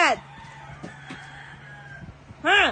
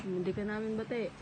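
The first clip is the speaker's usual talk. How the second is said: hushed aside; 9 semitones lower, 14 dB quieter, slower than usual. Real, not acted. Parent coaching child in secret.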